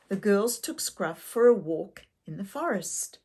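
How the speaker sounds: ordinary delivery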